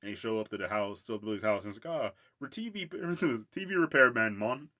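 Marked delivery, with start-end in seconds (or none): 1.9-4.7 mock Jamaican accent, loud and high; hammed-up Jamaican accent, breaking into laughter. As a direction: imitate Grimm in a Jamaican accent. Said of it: "Grimm has a Jamaican accent".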